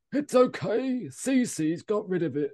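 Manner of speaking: high put-on soothing woman's voice; Cece. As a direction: start very high, slow down as you reassure. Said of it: "how I imagine Cece talks".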